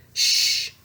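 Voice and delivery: breathy hushing shh; whispered shh. Acted. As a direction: hush them with a whisper. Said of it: "Thumper whispered".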